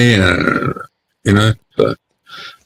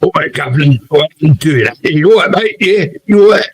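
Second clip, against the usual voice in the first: hammed-up Cockney geezer, rising higher and higher; overemphasised Cockney; 8 semitones higher than usual. Mocking Romeo's put-on accent, exaggerated Cockney getting higher. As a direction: lay the mock Cockney on thick, pitch climbing. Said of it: "I think he overemphasises it".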